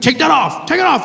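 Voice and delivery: high, loud barked order; mock-angry agent, high and loud. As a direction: snap at him to strip. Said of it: "more and more angry".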